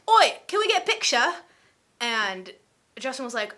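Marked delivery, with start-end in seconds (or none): 0.1-1.3 high, loud put-on English accent; hammed-up English accent, pitched high. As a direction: imitate an eager English fan, loudly. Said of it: "that was an English accent".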